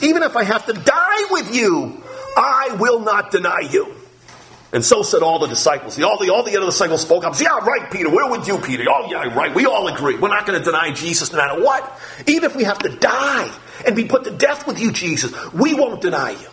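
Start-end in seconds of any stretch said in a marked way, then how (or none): 0.0-3.9 voiced Peter. 7.4-16.5 mock disciples. Disciples mockingly voiced.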